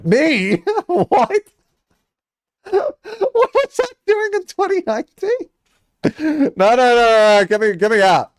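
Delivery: high, loud laughing shock; shocked disbelief. Real, not acted. Streamer spotting himself on an old leaderboard, shocked and laughing. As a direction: yelp it out, stunned to see your own name.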